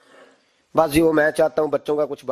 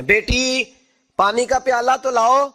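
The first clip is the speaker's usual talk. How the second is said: calling out; 7 semitones higher, 5 dB louder than usual. Retold or imitated. Father calls daughter from room.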